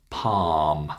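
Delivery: "Palm" said pahm, long vowel. Shown as a demonstration. Teacher repeats for students, long ah in "Palm". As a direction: hold the ah in "Palm". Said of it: "It's the long ah sound".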